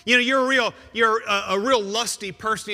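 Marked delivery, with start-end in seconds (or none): 0.0-1.6 louder and higher, flatly correcting; forceful rebuttal. Acted. Preacher rejecting a listener's excuse, forceful and raised. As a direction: cut through the excuse.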